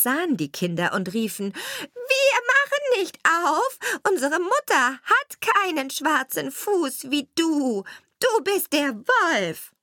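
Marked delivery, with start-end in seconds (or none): 1.5-9.7 put-on much higher, loud child cries; kids shouting. A narrator's reading. Goat kids yell at the wolf through the door. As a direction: shout him away, slow and shrill.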